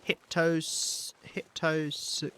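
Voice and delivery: halting stab at "Hyptos-", breaking off each time; trying to read "Hyptos-" aloud and giving up; fumbled name attempt. Real, not acted. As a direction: sound out "Hyptos-" unsure, cut short. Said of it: "I don't know why I tried".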